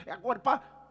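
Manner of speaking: loud, punchy call to act now; eager shout. Retold or imitated. Church security guard urging the pastor to act now, loud. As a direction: bark it like a guard itching to go.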